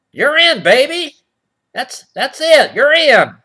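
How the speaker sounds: a hip doorman waving him in; high and loud, like a club bouncer letting him in; cool snake voice. Acted. Acting the snakes' reply.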